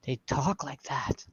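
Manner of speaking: soft, slow, hushed wonder; retold amazement. Retelling first hearing Tennessee talk, hushed amazement. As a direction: marvel under your breath, drawing it out.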